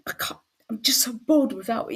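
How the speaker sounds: whiny quote. Retold or imitated. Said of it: "every time you go".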